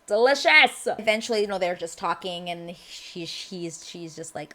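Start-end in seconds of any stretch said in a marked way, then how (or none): 0.1-0.9 louder, higher DELICIOUS with relish; hammed-up fangirl emphasis; emphatic gushing. Repeats word louder, gushing.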